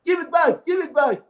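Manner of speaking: high yelled demand from the crowd; mock shouting at the ball holder; crowd screaming. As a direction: yell it like frustrated players. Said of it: "Everybody is screaming, shouting".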